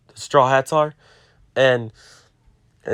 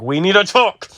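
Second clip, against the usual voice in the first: mock angry; 6 semitones higher than usual.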